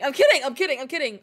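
loud, repeated take-back; hasty backpedalling. Pretend. Streamer taking back a fake goodbye, loud blurting. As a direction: blurt it out over the top.

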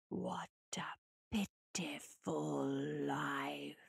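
low, slow, scornful whisper; whispering spirit voice. A narrator's reading. December mocking her life.

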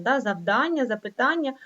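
ordinary delivery

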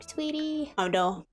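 ordinary talk

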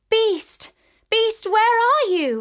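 high, loud frantic calling; desperate cry. A narrator's reading. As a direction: cry out for him in panic.

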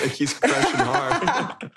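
slow teasing line through laughter; laughing. Real, not acted. Host teases cohost about a crush, laughing. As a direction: tease him while laughing.

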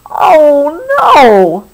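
loud, drawn-out storybook wail; dismayed cry. A narrator's reading. Billy sees cat and mouse playing, cries out in mock dismay. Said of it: "Billy cried".

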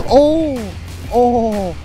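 sudden startled oh; surprised gasp. Real, not acted. Sudden bite, gasping surprise.

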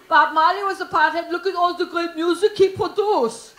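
loud mock German accent, insistent; German boyfriend. German pothead defending himself to girlfriend, loud mock German accent. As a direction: argue it in a comic German accent. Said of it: "And he's like".

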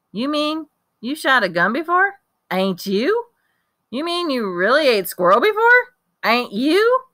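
can't believe what he hears; amazed boy. Acted. Kenny asks Rufus in amazement if he ate squirrel.

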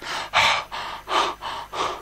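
heavy, breathy puffs, no voice; out-of-breath panting. A narrator's reading. Uncle Maximus winded from climbing rocks, panting. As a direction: pant hard as if winded from climbing. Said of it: "was out of breath".

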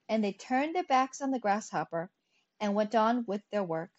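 plain reading voice

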